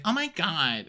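high breathy-free whine; mock whine. Host voicing Ashley whining to Gary, high pitched. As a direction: groan it like a damsel.

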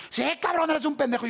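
high, heated retold retort; scolding agreement. Waiting man answering the speaker's apology, high scolding tone. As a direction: imitate an aggrieved man rubbing it in. Acting man's reply to the apology.

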